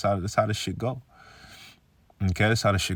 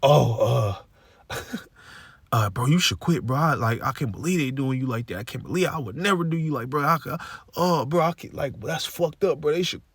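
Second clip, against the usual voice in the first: higher mock buddy voice, all "bro"; imitating his manager; 4 semitones higher than usual. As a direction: play the fake-sympathetic coworker urging him to quit. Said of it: "He's like".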